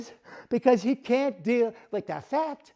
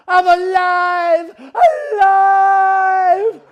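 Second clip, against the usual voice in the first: slow, high, loud cry of freedom; ecstatic shout; 8 semitones higher, 9 dB louder, much slower than usual. Acted. Freed dummy exulting, loud and drawn out. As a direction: bellow it to the rafters.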